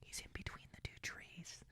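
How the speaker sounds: very faint, voiceless whisper. Retold or imitated. Hunter pointing out the buck to his cameraman, whispering. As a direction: whisper it as lightly as possible. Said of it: "he heard me whisper to Dylan".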